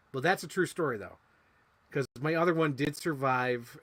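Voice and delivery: ordinary talk, nothing marked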